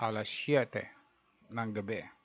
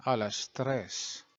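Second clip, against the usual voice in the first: ordinary talk, nothing marked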